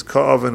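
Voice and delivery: "ka'aven" begun on a hard k; kaf with dagish. Shown as a demonstration. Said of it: "with a dagish".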